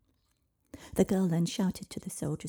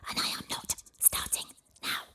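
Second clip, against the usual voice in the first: hissing serpent dragon. Acted. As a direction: hiss it like an offended serpent. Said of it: "hissed the dragon".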